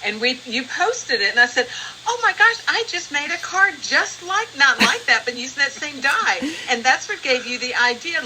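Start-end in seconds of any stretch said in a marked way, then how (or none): 2.1-6.4 excited exclamation. Retold or imitated. Retelling her excited reaction.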